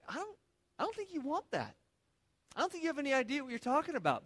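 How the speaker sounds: Samuel's doubtful reply. Retold or imitated. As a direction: Samuel scoffing at the people's request.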